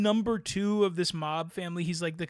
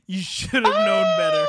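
much higher, louder, laughing scold; mock scolding shout; 8 dB louder than usual. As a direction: tease him loudly like a scolding parent. Teasing friend mock-scolds loudly.

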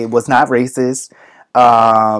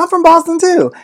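much higher delighted voice; excited mock reply. College student meeting a supposed fellow Bostonian, thrilled. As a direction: sound thrilled at a match.

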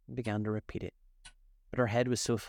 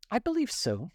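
thoughtful; 13 semitones higher, 3 dB louder than usual. A narrator's reading. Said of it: "Alice replied thoughtfully".

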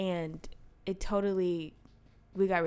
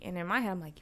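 ordinary talk, nothing marked

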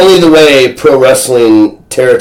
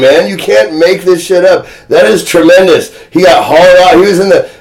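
ordinary talk, nothing marked

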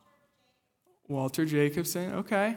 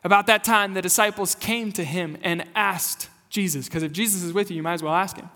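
usual speaking voice

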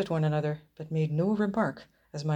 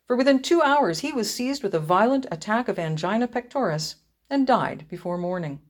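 plain reading voice

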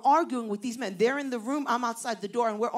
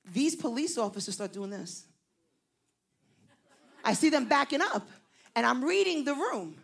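ordinary talk

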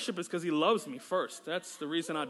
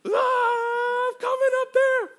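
much higher and louder, crying out upward; ecstatic cry; 8 dB louder, slower than usual. Retold or imitated. Worshipper calling up to God, crying out high.

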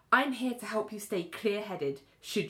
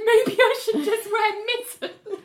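high, loud and slow through laughter; laughing while quoting Richard; laughing quote; 14 semitones higher, 8 dB louder, slower than usual. Retold or imitated. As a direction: barely get it out for laughing. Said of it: "And Richard says".

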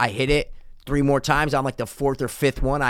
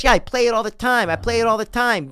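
put-on old lady voice; 9 semitones higher than usual.

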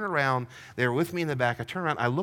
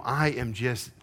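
ordinary talk, nothing marked

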